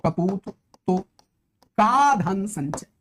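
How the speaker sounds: each word set apart with long pauses; drawn-out recital of an example line; slow verse recitation. Shown as a demonstration. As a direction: declaim the proverb slowly for the class. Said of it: "और देखो".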